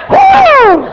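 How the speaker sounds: high, loud joyful whoop; excited whoop. Real, not acted. Preacher whooping with joy at God's power, loud excited whoop. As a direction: let out a happy holler.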